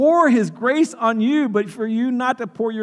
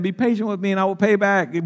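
ordinary talk, nothing marked

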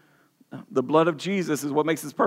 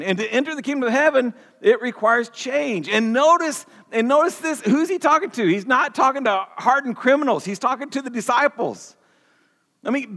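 higher-pitched, driving sermon voice; urgent preaching; 6 semitones higher than usual. Acted. Pastor urging his congregation that good people must change, fervent.

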